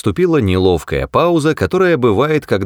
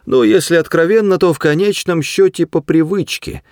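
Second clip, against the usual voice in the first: high, quick creature voice; piping mouse admission; frank little mouse; 10 semitones higher than usual. A narrator's reading. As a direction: confess it briskly, pitched up.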